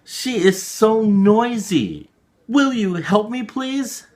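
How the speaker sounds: put-on high, eager little child's voice; pleading child. Child asking Yamamoto Bosan for help, high and pleading. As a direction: beg sweetly like a young kid.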